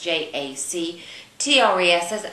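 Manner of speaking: spelling it letter by letter; each letter said apart, clear; spelled out. Shown as a demonstration. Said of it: "through Jack Trust".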